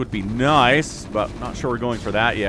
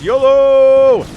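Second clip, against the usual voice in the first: yolo shout; 13 semitones higher, 14 dB louder than usual. Pretend. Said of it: "unloads the Reaver and says".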